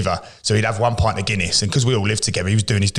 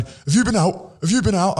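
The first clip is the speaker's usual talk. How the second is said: high mock accusing question; interrogating; 10 semitones higher than usual. Senior player questioning the new signing, mock accusing. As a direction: grill the young player.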